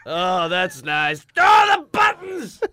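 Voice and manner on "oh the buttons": high, loud playful exclamation; a hammed-up yelp of excitement; mock excited cry. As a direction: squeal it out in mock delight. Mock cowboy exclaiming at jeans.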